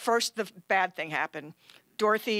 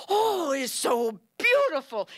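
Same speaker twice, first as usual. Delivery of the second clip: high, slow, wondering voice; awed guest; 5 semitones higher, 5 dB louder, slower than usual. Retold or imitated. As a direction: marvel at it like a child. Guest in awe at color.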